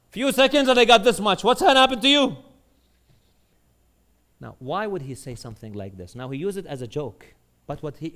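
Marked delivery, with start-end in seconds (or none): 0.1-2.4 high, loud acted punchline; exclaimed, raised-pitch reproach; incredulous warning. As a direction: drive the warning home at full voice. Bahlul's punchline warning to Harun.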